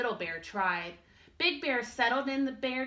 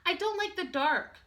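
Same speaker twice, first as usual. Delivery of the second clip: higher small cub voice; a tiny scared cub, pitched up; little bear cub; 9 semitones higher than usual. Acted. Little Bear tells Big Bear he is scared, high small voice.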